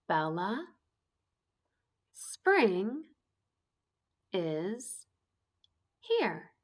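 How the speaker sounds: slow and choppy, pausing after every word; choppy reading. Shown as a demonstration. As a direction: read word by word with long pauses. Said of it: "when I'm using that choppy voice, when I'm reading word by word".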